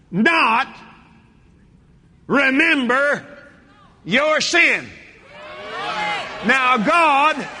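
loud, high shouted preaching; full-force revival shout. Acted. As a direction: shout it out over the congregation.

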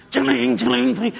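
loud, high ringing imitation; high-pitched metallic ching; slot machine jingle. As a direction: imitate casino machines jangling.

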